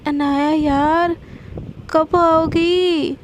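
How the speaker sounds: long drawn-out, whiny plea; playful whine. Acted. Sana on the phone urging Anaya to hurry, whiny. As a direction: stretch each word in an impatient whine.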